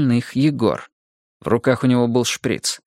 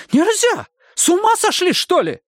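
yelling. Acted. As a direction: scream at them to stop. Nurse keeps yelling.

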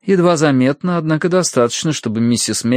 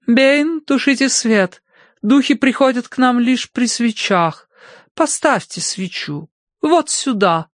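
high, drawn-out voice of an imperious medium; властная мадам-медиум, распоряжается; 14 semitones higher, slower than usual. A narrator's reading. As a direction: give orders as a grand séance madam. (высокий протяжный голос властной мадам)

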